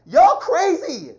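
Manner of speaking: loud, high, drawn-out angry shout; emotional outburst. Real, not acted. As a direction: yell it slowly, stretching out the words. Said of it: "you feel the emotion".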